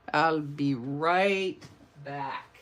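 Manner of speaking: slow, stretched RIGHT and BACK; drawn-out repeat. Pretend. Host playfully repeats her sign-off before going off screen, drawn out. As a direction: draw it out slowly and playfully. Replays the phrase, leading into Arnold.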